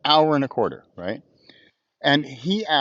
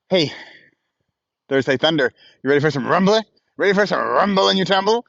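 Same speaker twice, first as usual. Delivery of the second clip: hype call; 4 semitones higher, 4 dB louder than usual. Real, not acted. Hyping up viewers.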